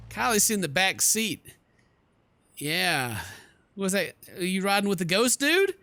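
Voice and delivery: ordinary delivery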